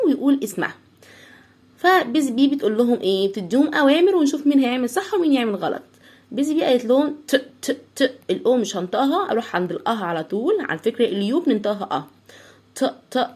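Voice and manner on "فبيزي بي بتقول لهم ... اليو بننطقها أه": normal talking voice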